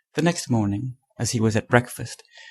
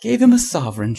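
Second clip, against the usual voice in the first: higher, louder echo of the words; incredulous shout; 6 semitones higher, 6 dB louder than usual. A narrator's reading. Trevor echoing Hughie's blunder back at him, shouting. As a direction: shout it back in amused disbelief. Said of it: "shouted Trevor".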